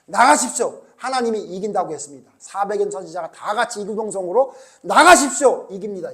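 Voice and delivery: loud, confident prophets' proclamation; proclaiming. Retold or imitated. Preacher voicing prophets loudly urging the king. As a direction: announce victory boldly.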